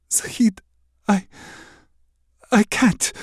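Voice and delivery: breathless then high, loud anguish. Acted. Princess refuses the prince in anguish, high. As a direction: tear yourself away. Benoit breaks away.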